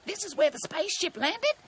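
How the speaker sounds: high-pitched bird voice; high, anxious kookaburra; kookaburra character. A narrator's reading. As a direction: explain in a high cartoon voice. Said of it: "Cookie explained".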